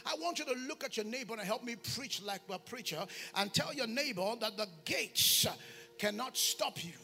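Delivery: normal talking voice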